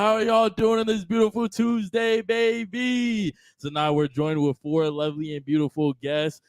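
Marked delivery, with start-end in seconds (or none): 0.0-3.3 hype host greeting. Real, not acted. Hype opening greeting to the chat.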